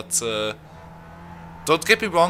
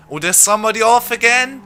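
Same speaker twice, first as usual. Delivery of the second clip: raised, loud burst of race excitement; excited commentary; 9 semitones higher, 5 dB louder than usual. Real, not acted. Commentator spotting somebody off at the final corner, excited. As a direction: call out the crash, pitched high and loud. Spots another car crashing in race.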